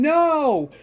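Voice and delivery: high, drawn-out loud cry; acted scream. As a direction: wail it out in horror. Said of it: "Herschel is screaming".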